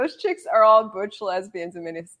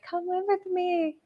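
a hint of a husband's voice, higher and slow; lightly voiced husband, slow and higher; husband inviting; 9 semitones higher, 8 dB quieter, slower than usual. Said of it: "he was just like".

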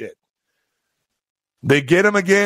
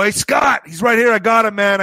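raised-pitch excited holler; calling out; 6 semitones higher than usual. Retold or imitated. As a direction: summon a friend from down the street. Calling friend over to captured thief.

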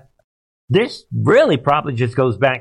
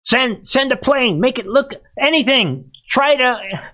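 higher, mocking panicky Biden voice; 11 semitones higher than usual. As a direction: sneer it out as a flustered Biden pleading. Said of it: "And he's like".

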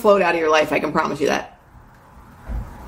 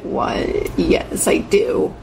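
excited whisper; much slower than usual. Retold or imitated. Thrilled reply to tour invite.

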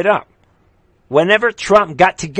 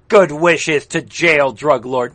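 ordinary delivery

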